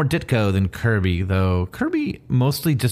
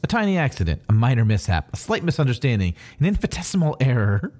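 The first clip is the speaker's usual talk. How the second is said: high, placating mock excuses; sheepish minimizing; 3 semitones higher, faster than usual. Captain Atom explains what happened, mock sheepish. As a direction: play it down with acted innocence.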